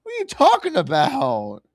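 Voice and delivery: high, baffled disbelief; incredulous. Real, not acted. Baffled by team mix-up.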